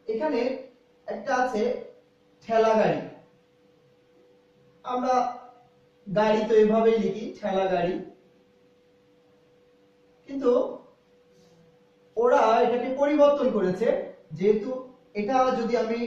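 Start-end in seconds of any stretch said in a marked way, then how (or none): none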